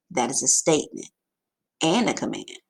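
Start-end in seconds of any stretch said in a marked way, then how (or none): none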